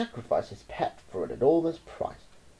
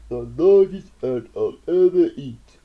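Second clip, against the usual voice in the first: hungry anteater; 2 semitones higher, 7 dB louder than usual. Acted. Anteater eyes the aunt as dinner, gloating. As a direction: relish the meal ahead. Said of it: "murmuring as it chewed".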